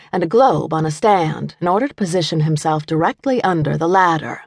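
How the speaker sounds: ordinary delivery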